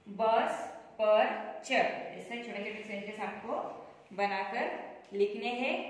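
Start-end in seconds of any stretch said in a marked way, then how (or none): 0.1-1.9 बस पर चढ़ read very slowly, word by word; बहुत धीरे, शब्द-शब्द अलग करके; slow, careful reading. Shown as a demonstration. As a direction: spell out बस पर चढ़ slowly for children. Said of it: "तो यहाँ पे".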